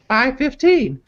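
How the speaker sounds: put-on high falsetto girlfriend voice reading texts; girly voice. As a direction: read her lines in a squeaky girly voice. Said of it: "girly voice for this part".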